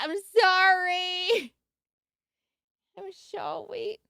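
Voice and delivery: slow, drawn-out sing-song apology; mock sorry. Apologizing to chat for the howling, slow and sing-song. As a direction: stretch the sorry out sweetly.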